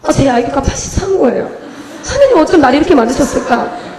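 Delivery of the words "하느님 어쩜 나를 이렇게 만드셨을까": 높고 크게 치솟는 hammed-up despair; 과장된 절망. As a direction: cry out to God in mock despair. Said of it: "제가 그때 한번 절망했을 때가". (절망한 척 하늘에 외치듯)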